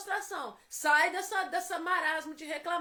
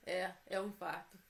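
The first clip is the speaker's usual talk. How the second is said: low, quiet husband's answer; husband's reply; 9 semitones lower, 7 dB quieter than usual. Retold or imitated. Wife recalls husband confirming a heart attack, low quiet reply.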